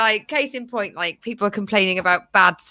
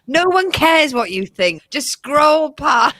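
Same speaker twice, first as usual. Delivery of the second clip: louder, higher, slow and drawn out; exasperated telling-off; 4 semitones higher, 5 dB louder, slower than usual. Acted. Podcaster telling off online commenters, loudly exasperated. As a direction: stretch it out, loudly fed up.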